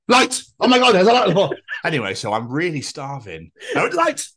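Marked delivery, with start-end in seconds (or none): 0.1-1.6 high, loud surprised cry; startled shout. Real, not acted. Spots a light on floor. 3.7-4.2 startled yelp. Real, not acted. Spots light again.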